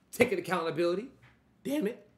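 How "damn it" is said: voice crack. Real, not acted. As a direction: voice cracks up high on the words. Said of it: "Just voice crack".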